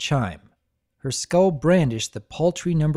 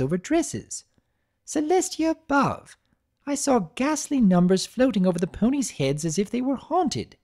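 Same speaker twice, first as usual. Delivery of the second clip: refined lady voice; 7 semitones higher than usual. Acted. Rarity speaking at length.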